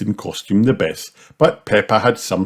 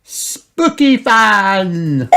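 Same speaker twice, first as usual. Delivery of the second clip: put-on high, loud, very drawn-out child; Peppa Pig; 13 semitones higher, 5 dB louder, much slower than usual. A narrator's reading. Peppa checking with Granny, high and drawn out. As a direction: stretch it out in a squeaky child voice. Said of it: "asked Peppa".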